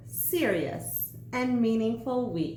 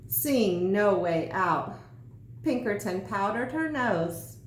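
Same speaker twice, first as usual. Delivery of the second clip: ordinary delivery, nothing marked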